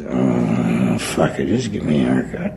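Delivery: low, laughing junkie give-up. Retold or imitated. Junkie gives up on the shave, low and laughing. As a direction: drop it low and slurred, half laughing.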